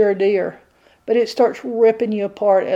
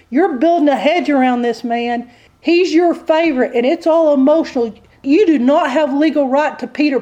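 indignant devil, voice pitched up; accusing devil; 4 semitones higher, 4 dB louder than usual. Acted. Devil disputes God's hedge around Peter, accusing. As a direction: object forcefully as the prosecuting devil. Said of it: "The devil presents the evidence".

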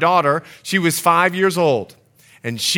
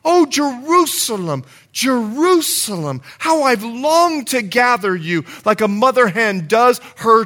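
high, fervent sermon delivery; impassioned preaching; 6 semitones higher than usual. Real, not acted. Preacher showing the congregation the heart of Jesus, impassioned. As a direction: preach it with urgency, voice raised high.

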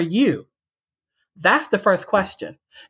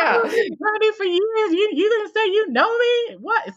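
incredulous indignation. Retold or imitated. Retold indignant inner thoughts.

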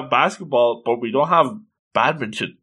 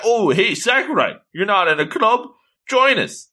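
high, friendly character greeting; acted upbeat club pitch; eager recruiting; 8 semitones higher than usual. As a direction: invite them in with pitched-up enthusiasm. Acted club member recruiting Sakurai.

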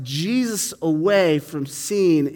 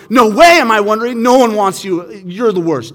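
put-on loud, higher childish denial; defensive outburst; 6 semitones higher, 12 dB louder than usual. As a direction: lash out to cover the truth. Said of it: "He's going".

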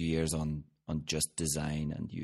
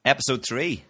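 announcing; 8 semitones higher, 8 dB louder than usual. Acted. Announcing the episode.

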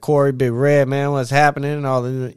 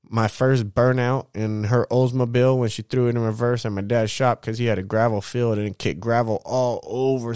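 ordinary talk, nothing marked

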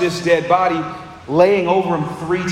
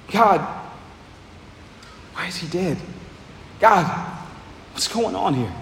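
lament; 6 dB quieter than usual. Retold or imitated. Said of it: "He cries a prayer of lament".

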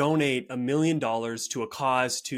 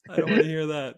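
laughing; 5 semitones higher, faster than usual. Real, not acted. Joking reply to hard truth.

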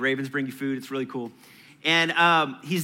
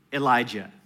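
normal talking voice

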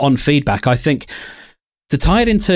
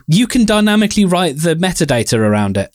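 ordinary talk, nothing marked

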